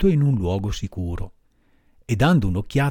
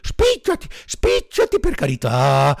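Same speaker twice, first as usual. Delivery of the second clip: high, loud, begging dog voice; high panicked dog urging; urgent drowning dog; 6 dB louder than usual. A narrator's reading. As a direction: hurry him, life or death. Drowning dog urges Pinocchio.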